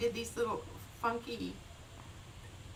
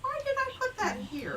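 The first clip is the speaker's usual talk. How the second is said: retold puzzled self. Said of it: "and I was like".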